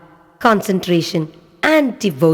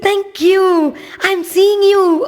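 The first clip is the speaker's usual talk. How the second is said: much higher and louder, slow with joy; delighted boy; 14 semitones higher, 4 dB louder, slower than usual. Acted. Little boy thanking Lord Shiva on seeing him, overjoyed. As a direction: cry out your joy like a thrilled child. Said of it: "he was so happy".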